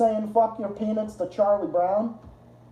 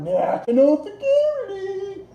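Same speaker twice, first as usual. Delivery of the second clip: mocking tone; 13 semitones higher than usual. Mocking officials' fancy term.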